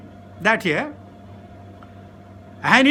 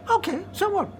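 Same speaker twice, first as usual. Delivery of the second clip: high, offhand mock indifference; uninterested shrug; 9 semitones higher, 5 dB quieter than usual. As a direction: brush it off lightly, pitched up. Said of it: "we're not interested".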